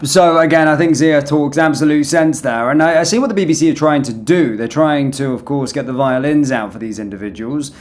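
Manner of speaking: ordinary talk, nothing marked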